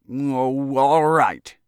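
loud, high grudging give-in; grudging surrender. A narrator's reading. Lobbyist gulping down wrath and pride, grudging surrender. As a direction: force it out past swallowed pride. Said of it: "he muttered at last".

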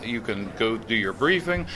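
ordinary delivery

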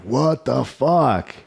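slow, drawn-out stunned exclamation; slow disbelief. Retold or imitated. Seeing the plays climb, a slow, stunned exclamation. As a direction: let it stretch out in disbelief. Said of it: "I was like".

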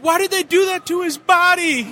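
high, loud mock wail of dismay; imitating appalled moviegoer. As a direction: cry it out, scandalised, high and loud. Quotes appalled person in next seat.

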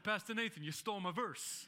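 hammed-up complaint from a buddy; Rocco impression. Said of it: "That's my Rocco impersonation".

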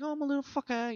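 squeaky mock insect; tiny wasp voice. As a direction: put on a little pest's voice.

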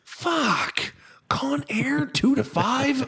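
high-pitched, hammed-up dismay; exasperated board operator. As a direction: play a put-upon operator groaning at yet another airing. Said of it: "that poor master control board operator".

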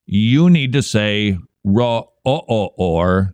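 "ra, uh, uh, or" drawn out slowly in four syllables; roar in four syllables. Shown as a demonstration. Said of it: "sing it with four syllables".

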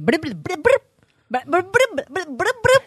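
high, loud burbling gibberish; imitated sped-up screen reader. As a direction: mimic speech sped up into stuttering noise. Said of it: "พอฟังก็เป็นอย่างนี้เลย". (เลียนเสียงอ่านเร็วจนเป็นเสียงรัวฟังไม่รู้เรื่อง)

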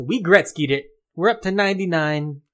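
ordinary delivery

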